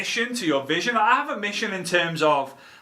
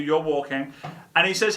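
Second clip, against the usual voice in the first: ordinary talk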